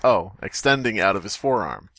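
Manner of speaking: ordinary talk, nothing marked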